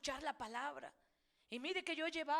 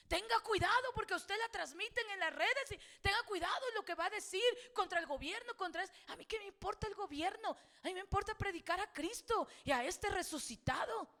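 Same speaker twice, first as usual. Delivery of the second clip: defiant preacher, raised voice; fiery sermon warning; 4 semitones higher, 4 dB louder than usual. Acted. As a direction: preach it louder and higher, building. Predica a plena fuerza. (predicación encendida, voz alzada)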